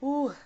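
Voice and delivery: real emotional exclamation; emotional ooh. Real, not acted. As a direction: let the feeling spill out in one sound. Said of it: "I am getting so emotional".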